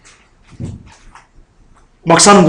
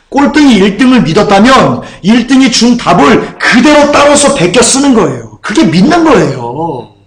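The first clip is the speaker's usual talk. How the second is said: full-force preaching; 4 semitones higher than usual. Real, not acted. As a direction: drive the point home from the pulpit. (높고 크게 힘주어 설교하듯)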